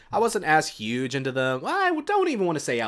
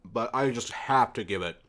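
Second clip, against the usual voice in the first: mouth full; 2 semitones lower than usual. Real, not acted. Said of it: "I shouldn't talk with my mouth full".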